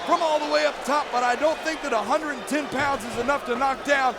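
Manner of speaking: ordinary talk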